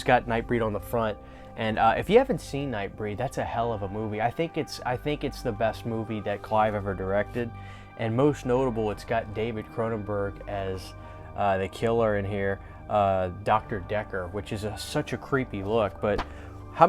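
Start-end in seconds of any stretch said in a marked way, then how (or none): none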